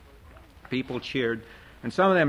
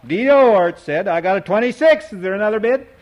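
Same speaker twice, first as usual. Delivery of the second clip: much higher, louder auctioneer cry; auctioneer's call; 8 semitones higher, 9 dB louder than usual. A narrator's reading. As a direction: sing out the bid like an auctioneer. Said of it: "his auctioneer's talk".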